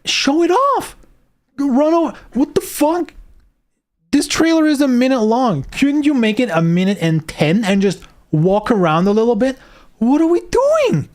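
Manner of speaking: high-pitched exasperated ranting; incredulous rant. Real, not acted. Vlogger to viewers about the trailer, exasperated high rant.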